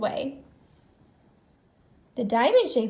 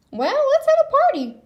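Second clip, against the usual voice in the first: much higher, louder cheery idea; excited Goofy; 14 semitones higher, 7 dB louder than usual. A narrator's reading. Goofy proposes a party to Beast Pete, bright and loud. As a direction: burst out with a happy idea.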